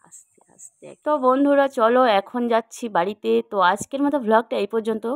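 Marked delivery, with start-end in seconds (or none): 0.1-0.9 breathy whisper, no voice; whispering to viewers near the birds. Real, not acted. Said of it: "আস্তে আস্তে কথা বলছি চুপির সারে".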